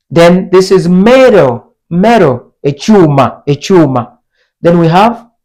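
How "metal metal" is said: METAL with a flapped T, like "meh-ro"; American soft T. Shown as a demonstration. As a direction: soften the T in "metal" to a tap. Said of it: "like a soft D".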